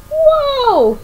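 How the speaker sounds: high, loud startled yelp. A narrator's reading. Pleakley tripping over Jumba's foot, high loud yelp. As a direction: yelp high as the wig flies off. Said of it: "he yelled as his wig went flying".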